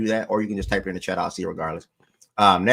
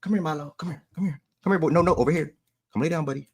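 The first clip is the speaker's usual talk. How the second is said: coaxing nice voice for a dog, a bit higher; sweet-talking the dog; 6 semitones higher, faster than usual. Real, not acted. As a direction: coax the dog over gently. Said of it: "Now I gotta put the nice voice on".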